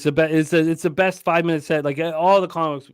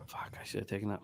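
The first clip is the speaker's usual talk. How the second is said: hushed frustrated whisper; 8 semitones lower, 18 dB quieter, faster than usual. Real, not acted. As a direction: breathe it out under your breath. Frustrated aside, muttered.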